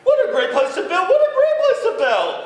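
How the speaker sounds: very high, loud, fast laughing bird; a giddy little bird chirping. Acted. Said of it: "he chirped".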